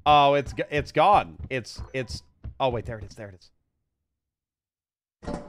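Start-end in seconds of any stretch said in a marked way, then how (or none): none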